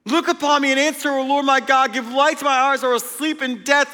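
high, loud, impassioned recitation; re-enacting shouting scripture alone on the couch; screaming the psalm. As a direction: cry the verses out with all you've got. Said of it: "And I'm screaming this".

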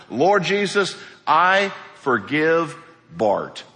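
slow, earnest prayer of forgiveness, drawn out; an unhurried prayer of release, gently voiced; sample prayer. Shown as a demonstration. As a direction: pray it slowly, as if letting someone go. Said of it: "I'll help you".

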